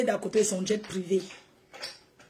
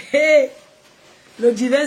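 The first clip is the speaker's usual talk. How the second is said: calling out; 3 semitones higher, 7 dB louder than usual. Real, not acted. Calling out to viewers.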